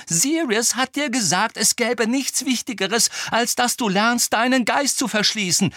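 high, insistent argument; heated reproach. A narrator's reading. Hermine reminding Harry of Sirius's advice, heatedly. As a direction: talk over him heatedly.

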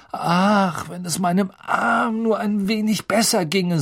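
sighing. A narrator's reading. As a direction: sigh theatrically over the arm. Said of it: "seufzte er".

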